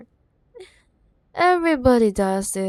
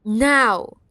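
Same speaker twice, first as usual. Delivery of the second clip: sharp command; 8 dB louder than usual. Acted. Ordering the ex to fetch it.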